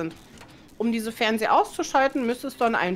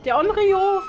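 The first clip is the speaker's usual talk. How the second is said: startled shout; being chased; 9 semitones higher, 6 dB louder than usual. Real, not acted. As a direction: shriek the name. Spots killer, scream.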